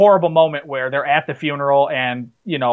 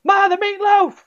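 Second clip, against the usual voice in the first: imitating a shout; slower than usual. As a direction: call out for mom like a whiny grown man. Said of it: "And he shouts".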